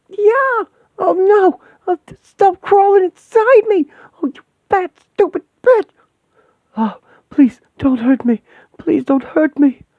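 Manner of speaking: very high, loud shrieks and begging; terrified screaming. Acted. Woman attacked by the demon, screaming and begging. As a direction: shriek as something crawls inside you.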